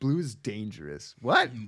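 stiff, wooden line reading; bad acting. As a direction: read it like a bad actor. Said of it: "the line delivery is terrible".

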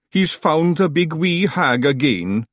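high Scottish Feegle voice; slow, high, loud little Scots warrior. A narrator's reading. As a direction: call it out as a wee Scottish clansman. Feegle character speaks in the story.

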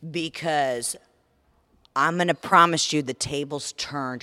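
ordinary talk

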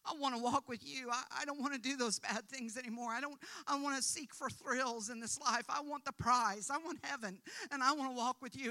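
earnest, fervent model prayer; sample prayer. Shown as a demonstration. Preacher voicing a sinner's prayer for the listeners, fervently. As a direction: plead it with rising fervour. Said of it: "the moment you say".

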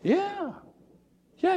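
normal talking voice

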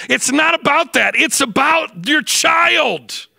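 loud, high preaching at full pitch; fiery preaching. Acted. Preacher pleading with the congregation at full force.

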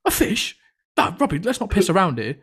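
high squeak of disbelief on fish; hammed-up incredulous teacher; mock disbelief. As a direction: imitate the teacher's shocked "A fish?". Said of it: "she went".

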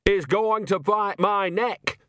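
high, drawn-out indignant shout; outraged character yell. A narrator's reading. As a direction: shout it slowly, voice pitched right up. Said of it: "shouted Nanny".

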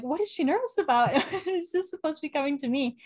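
high-pitched, laughing bewilderment; baffled, laughing. Retold or imitated. Fan recalling a player's surprise message, baffled and laughing. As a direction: puzzle it out through laughter.

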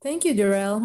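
ordinary talk

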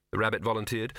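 usual speaking voice

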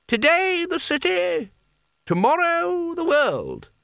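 very high, drawn-out cry; high, slow rallying call; voice from the crowd. A narrator's reading. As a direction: stretch it out high like someone calling from the back of a crowd. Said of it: "said someone at the back of the crowd".

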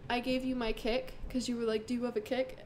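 ordinary delivery

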